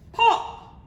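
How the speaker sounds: high, loud popping exclamation; sudden bang. A narrator's reading. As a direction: burst it out like an explosion. Palace bursts with tears.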